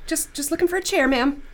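high, timid mock excuse; pretend-nervous politeness; meek. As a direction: placate an aggressive stranger, half joking. Playing a meek reply to the threat.

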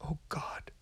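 frightened breathless whisper. Acted. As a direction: hush it out in dread. Terror, whispered.

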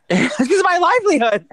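high, laughing mock plea; giggling through the words. Real, not acted. Laughing mock-dramatic about the award.

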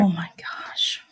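breathless whispered gasp of shock; stunned whisper. Real, not acted. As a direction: whisper it, stunned by what you just heard. Listener reacts to near-drowning story.

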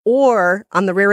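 ordinary talk, nothing marked

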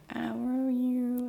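put-on slow, high little-kid voice; toddler voice. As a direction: say it like a small toddler. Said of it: "he was like".

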